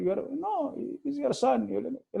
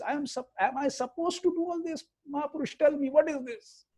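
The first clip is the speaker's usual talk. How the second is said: exasperated confiding; 8 semitones higher than usual. Retold or imitated. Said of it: "This is what he speaks to me".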